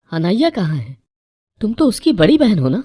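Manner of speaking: high, loud, alarmed questioning; mother-in-law confronting the wrong bride, alarmed; shocked demand. A narrator's reading. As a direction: demand answers in disbelief.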